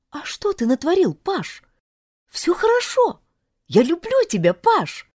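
high-pitched, fervent reassurance; reassuring, loving heroine. Acted. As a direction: reassure him eagerly in a high voice. (влюблённая девушка горячо успокаивает, высоко)

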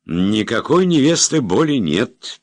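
put-on slurred, high and loud drunken protest. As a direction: say it like a tipsy prince waving it off. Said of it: "пьяно мотнул головой".

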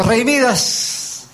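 high, long drawn-out call; fairy godmother calling. Acted. Fairy godmother appears before King Midas, calling him grandly.

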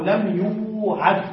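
لم يوعد spelled out very slowly; slow teaching read of لم يوعد; slow demo. Shown as a demonstration. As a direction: say لم يوعد slowly, each sound clear. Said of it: "نحو".

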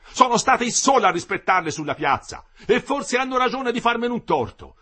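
loud, high angry protest; the engineer defending himself, loud and angry; indignant outburst. A narrator's reading. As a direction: keep the offended heat going. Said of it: "scattò l'ingegnere".

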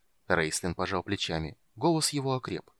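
usual speaking voice

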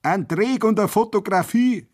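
very high mocking hoot; jeering holler. A narrator's reading. Prisoner hoots down at the soldiers for laughs, jeering. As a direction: hoot it down like a heckler. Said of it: "johlte einer hinunter".

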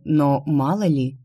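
usual speaking voice

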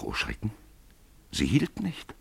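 usual speaking voice

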